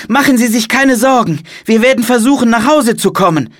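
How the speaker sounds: put-on high, loud child's voice; eager boy. A narrator's reading. Boy reassures monk they will get home, eager and high. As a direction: speak up high and earnest.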